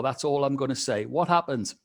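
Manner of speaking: ordinary talk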